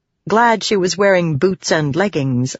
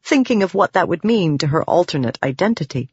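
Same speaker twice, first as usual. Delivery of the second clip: plain reading voice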